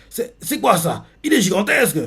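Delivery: lightly acted shock at a monster. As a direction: gasp it out at the giant. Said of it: "Le Maléfique choqué fait".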